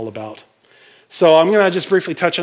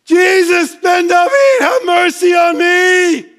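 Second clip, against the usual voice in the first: high, loud, drawn-out cry for mercy; desperate shout; 8 dB louder, slower than usual. Retold or imitated. Beggar shouting to Jesus over the crowd, desperate cry. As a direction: scream it over the crowd, begging. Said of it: "No, it's".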